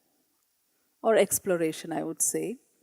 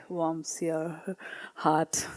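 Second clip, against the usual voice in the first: laughing whisper; 3 semitones lower, 7 dB quieter, much slower than usual. Real, not acted. Fond aside after reading poem.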